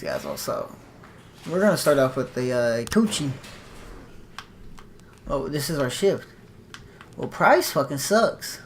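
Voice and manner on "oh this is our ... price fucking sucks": normal talking voice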